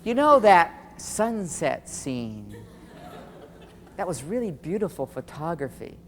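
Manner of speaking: slow, drawn-out groping for a compliment; careful, searching praise. Retold or imitated. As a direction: stretch it out as if searching for something kind. Wife searching for something nice to say.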